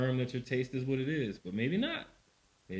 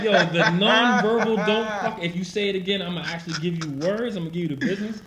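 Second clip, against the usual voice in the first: laughing amazement; 8 semitones higher, 11 dB louder than usual. Retold or imitated. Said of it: "I was just like".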